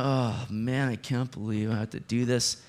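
grudging grumble. Retold or imitated. Pastor mimics a begrudging churchgoer at the offering box, mock grumble. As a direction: sigh it out like a put-upon giver. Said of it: "with grumbling".

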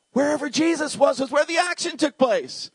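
high, ringing proclamation; fired-up preaching. Real, not acted. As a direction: declare it with soaring pitch. Preacher proclaims with rising fervour.

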